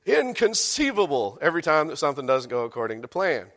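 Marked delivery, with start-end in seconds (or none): 0.0-1.4 shocked disbelief. Retold or imitated. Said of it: "he says with shock and consternation".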